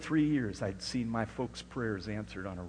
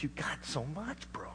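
hushed whisper; 4 semitones higher, 8 dB quieter than usual. Retold or imitated. Roommate's words retold hushed.

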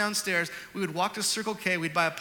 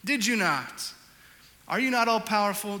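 full-force preaching; 3 semitones higher, 5 dB louder than usual. Acted. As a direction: preach it like a rallying cry.

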